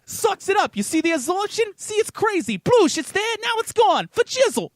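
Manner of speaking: high, loud, hyped-up infomercial pitchman; Vince infomercial pitch. Retold or imitated. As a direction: sell it like a late-night TV huckster. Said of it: "Vince sold them, the, the infomercial guy".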